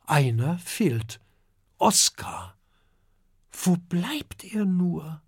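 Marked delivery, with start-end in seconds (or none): 3.5-5.2 higher, slow, wondering little dragon voice; puzzled, slow murmur to himself; Kokosnuss thinking. A narrator's reading. Kokosnuss wonders where Oskar is, puzzled and slow. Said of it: "denkt Kokosnuss".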